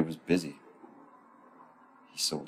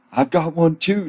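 high-pitched excited call; shouting; 11 semitones higher, 4 dB louder than usual. Acted. Emma shouts her catch to Stan, high excited shout. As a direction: shout it high, rising at the end. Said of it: "shouted Emma".

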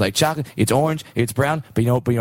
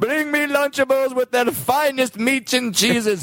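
high, grand king's command; pompous proclamation; royal decree; 10 semitones higher than usual. Acted. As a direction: proclaim it to the court.

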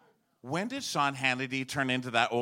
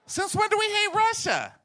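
very high, squealed protest; incredulous mock outrage. Comic challenging liberals about Russia, shrieking disbelief.

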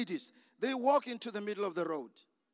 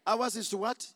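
usual speaking voice

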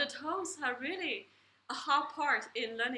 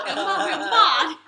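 laughing loudly; 4 semitones higher, 10 dB louder than usual. Real, not acted. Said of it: "laughing joke about mom".

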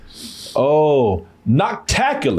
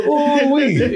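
high put-on voice, drawn out; mock exclamation; 8 semitones higher than usual. Streamer quoting a caller, high mock voice. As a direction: ham up the caller's delight.

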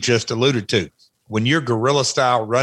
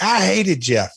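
mock fan disgust; 8 semitones higher than usual. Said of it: "people are like".

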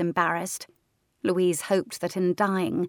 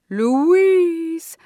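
high, loud drawn-out ghost moan; ghostly moan; 11 semitones higher, 10 dB louder than usual. Acted. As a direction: moan the name like a haunting spirit. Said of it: "her ghost moaned".

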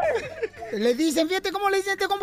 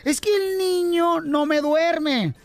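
slow, drawn-out whiny mother; lightly mocking a mom's complaint; mimicking mothers; 3 semitones higher, slower than usual. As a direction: drag it out like a tired mom complaining. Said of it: "la frase esa que dicen".

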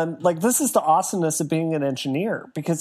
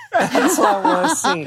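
laughing; 5 semitones higher, 5 dB louder than usual. Real, not acted. Teasing a cohost about suits.

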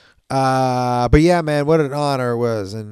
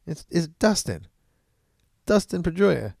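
much higher, insistent hurt correction; indignant correction; 7 semitones higher than usual. Retold or imitated. Pedroia correcting Papi about his own name, high and insistent. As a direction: set the record straight, a little wounded. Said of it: "And he goes".

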